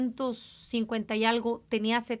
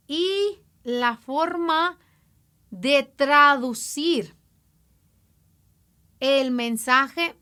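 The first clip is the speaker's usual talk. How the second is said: slow deliberate emphasis; 4 dB louder, much slower than usual. Real, not acted. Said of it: "slow emphatic explanation".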